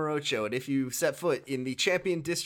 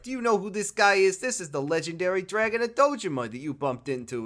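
mock tough guy; 7 semitones higher, 4 dB louder than usual. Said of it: "He's like".

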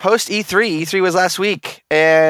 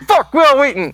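louder, laughing blurt; 9 semitones higher, 5 dB louder than usual. Retold or imitated. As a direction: blurt it out loud. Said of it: "I said it quite loud".

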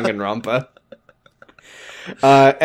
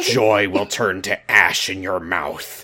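menacing villain; 5 semitones lower than usual. Acted. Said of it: "this fucking Joker".

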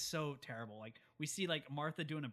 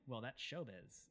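quoting Miss Jarvis; 3 semitones lower, 9 dB quieter, slower than usual. Retold or imitated. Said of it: "he's quoting Miss Jarvis here".

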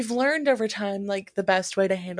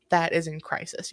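usual speaking voice

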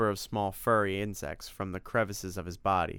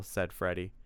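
plain reading voice

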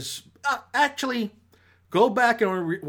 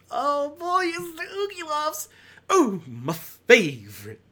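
high, hammed-up mock delight; sarcastic fake joy; 13 semitones higher than usual. Mocking a hated movie with exaggerated fake enthusiasm. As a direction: pretend you're thrilled, dripping irony.

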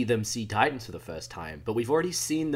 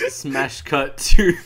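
laughing drawn out; 11 dB louder, much slower than usual. Real, not acted. Joking about a comic cut.

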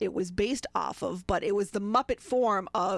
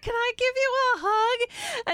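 excited; 3 dB louder than usual. Retold or imitated. Asking mascot for hug.

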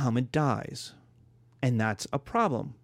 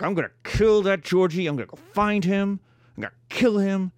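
mock angry threat; 6 semitones higher, 4 dB louder than usual. Teacher acts angry tribesman.

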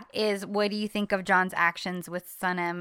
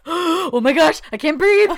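much higher and louder, breathless gush; swooning fans; 8 semitones higher, 9 dB louder than usual. Retold or imitated. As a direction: swoon like an overwhelmed fan. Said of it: "Everyone was like".

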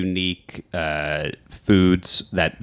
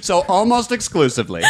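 laughing. Real, not acted. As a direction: burst out laughing while teasing. Host teases guest.

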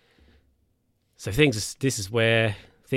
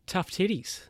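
smug dismissal; 8 semitones higher, 3 dB quieter than usual. Retold or imitated. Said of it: "the guy's like".

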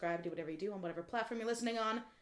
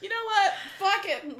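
giving up; 10 semitones higher, 10 dB louder than usual. Real, not acted. Gives up after flubs.

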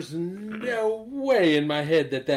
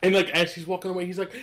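usual speaking voice

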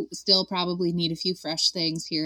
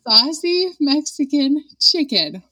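slow, sultry, higher radio purr; sexy radio voice; 7 semitones higher, 4 dB louder, slower than usual. Pretend. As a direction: seduce the listener, slowly. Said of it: "I said it without laughing".

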